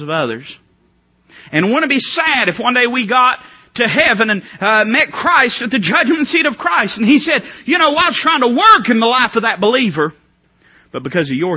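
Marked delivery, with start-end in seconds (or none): 1.5-10.1 loud, high, urgent preaching; fiery preaching. Acted. Preacher warns the congregation, rising to a shout. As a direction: preach it out with mounting force.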